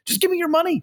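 a fed-up mugger squeaking out his demand; exasperated robber. Acted.

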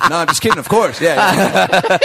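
high-pitched, laughing take-back; retold joking reassurance. Retold reversal of a joke refusal.

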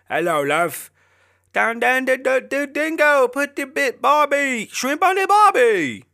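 Australian accent impression. Joking about picking up an Aussie, hammed-up loud high Australian accent. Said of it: "get you a Aussie".

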